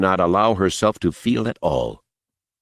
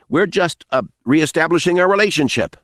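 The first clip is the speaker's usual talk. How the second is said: nervous high voice; 9 semitones higher than usual. Acted. Smiley strained, nervous cover.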